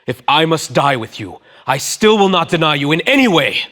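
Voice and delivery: high and loud, swearing it fiercely; vehement. Acted. Peter vowing to Jesus he will never deny him, vehement. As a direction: insist with rising force. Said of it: "spoke more vehemently".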